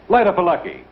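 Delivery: ordinary talk, nothing marked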